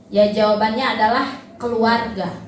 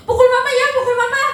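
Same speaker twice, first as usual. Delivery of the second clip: put-on playful baby talk; 10 semitones higher, 4 dB louder, faster than usual. Parent teasing toddler to hit mama.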